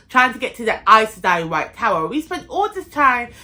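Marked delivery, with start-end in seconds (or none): none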